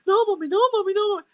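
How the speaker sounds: put-on high, loud pleading child voice; pleading kid. Kids begging mommy not to use the stick, high pleading. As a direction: beg like a scared little kid.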